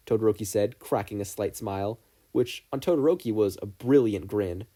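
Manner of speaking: usual speaking voice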